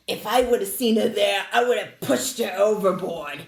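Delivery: put-on thick Boston accent with dropped r's; imitated Boston lady. Bus driver disparaging Elizabeth Warren, Boston accent. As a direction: mimic a blunt Massachusetts local's Boston accent. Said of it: "And the lady next to her goes".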